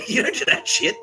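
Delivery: high squeaky telling-off through laughter; loud, giggly falsetto reproach; laughing mock scolding. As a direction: repeat the warning while cracking up. Acted scolding repeated through laughter.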